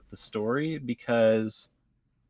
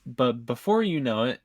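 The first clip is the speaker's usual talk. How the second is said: ordinary talk, nothing marked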